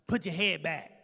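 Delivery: snapped at, no greeting; curt order. Retold or imitated. Irritated nurse barking an order. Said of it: "first thing she said".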